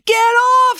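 high, loud, furious yell; enraged scream. A narrator's reading. Officer loses his temper with the dog, enraged scream. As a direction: scream it, totally losing your temper. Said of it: "screamed Officer Odinson".

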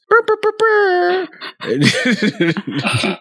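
high slow brr brr like a phone; phone ringing noise. Acted. Player makes ringing noise.

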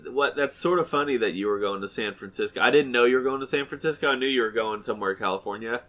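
normal talking voice

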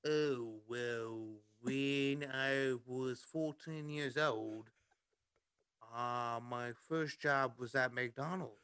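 slow, put-on Liverpool storytelling; goofy Liverpool accent. Telling friends about a first McDonald's job, Liverpool accent. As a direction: tell it in a mock Liverpool accent. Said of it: "Liverpool accent the rest of the night".

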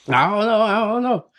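put-on half-hearted child voice fretting over a question; mildly flustered kid. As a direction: lightly play the daughter getting overwhelmed. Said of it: "She freaks out a little bit".

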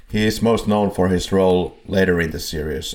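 usual speaking voice

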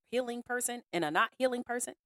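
ordinary talk, nothing marked

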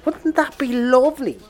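sweet-talk, rising high at the end; coaxing sales pitch. Acted. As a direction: talk it up like a dream on offer. Brother coaxes sister with the payout.

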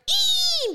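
very high, loud little yip; mock chihuahua squeal; chihuahua squeak. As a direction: squeak like a tiny dog copying its owner. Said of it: "his little chihuahua next to him was like".

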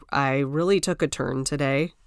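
ordinary delivery

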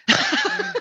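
short burst of laughter, up high; real laugh. Real, not acted. Laughs at client's answer.

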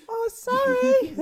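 very high, loud squeal; mock terrified apology. Friend mocking a scared boyfriend's apology, squealed panic. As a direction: squeal it slowly, hammed-up panic.

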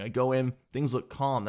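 ordinary delivery

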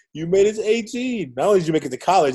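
high, cheering "you made it"; a bright, high cheer of congratulations; celebrating. Retold or imitated. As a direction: toast him like a proud friend. Friends celebrating turning eighteen.

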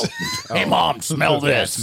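hammed-up call to Mom. Shown as a demonstration. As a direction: call out hey then slow smell this. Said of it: "you should start off with".

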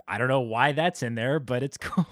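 usual speaking voice

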